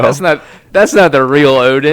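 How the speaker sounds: loud protest. Real, not acted. Playful argument about real Odin.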